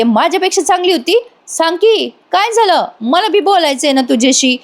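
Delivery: high, indignant echo question; acted jealous challenge, pitched well up. Jealous girl asking if the other was prettier, indignant.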